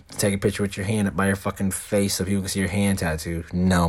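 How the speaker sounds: ordinary talk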